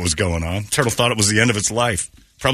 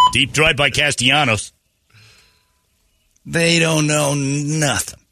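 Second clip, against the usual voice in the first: hammed-up disgusted ranting, higher; mock rant; 5 semitones higher than usual. Mocking the zoo's gorillas in a higher ranting put-on voice. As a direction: rant about the gorillas like a cranky old keeper.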